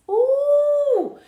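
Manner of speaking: high excited ooh; delighted ooh. Real, not acted. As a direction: coo with delight. Cupcakes surprise.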